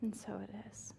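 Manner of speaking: gentle, unhurried spoken blessing; memorial prayer. Real, not acted. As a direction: lead a hushed congregation in prayer, fading to near-whisper.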